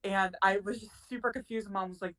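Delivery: normal talking voice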